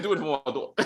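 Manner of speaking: a hint of [name]'s voice; [name] impression. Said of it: "that's supposed to be a [name] accent".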